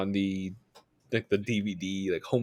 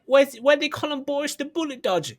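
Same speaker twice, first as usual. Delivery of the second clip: much higher mock Cockney gangster voice; mock Cockney thug. Mocking Guy Ritchie film dialogue, in a high mock Cockney voice. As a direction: ham up a Cockney movie geezer, high and sing-song. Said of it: "they'd be like".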